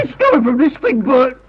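alarmed, pressing plea; frightened insistence. Acted. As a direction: insist on the danger to a disbelieving friend. Insists the ticking is beside him.

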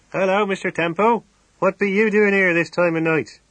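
high, loud rustic farmer's voice calling through the window; a hearty, burly farmer hailing a neighbour; country farmer. Acted. Farmer greets his neighbour Temple at the car window, hearty and loud.

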